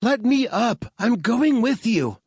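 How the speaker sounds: high, loud demand to be freed; frustrated demand. Acted. Strapped-down woman demanding to go along, high and loud. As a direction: fight the straps and insist. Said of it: "frustrated shriek".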